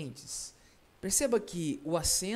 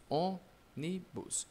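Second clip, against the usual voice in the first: "Ônibus" split slowly into three parts; "Ônibus" in separate, softer syllables; 6 dB quieter, much slower than usual. Shown as a demonstration. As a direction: say "Ônibus" as ô, ni, bus. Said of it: "dá pra separar?".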